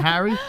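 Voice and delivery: Harry with a short "a" as in "hat"; "Harry" with an open "a", rising; corrected pronunciation. Shown as a demonstration. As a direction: prompt "Harry" with the "cat" vowel. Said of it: "I'm saying it like that".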